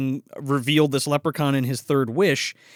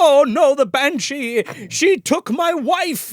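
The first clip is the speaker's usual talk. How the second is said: high, loud wail of dread; mock anguish; 9 semitones higher, 4 dB louder, slower than usual. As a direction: cry out in hammed-up horror, drawn out. Acting husband's horror at the banshee.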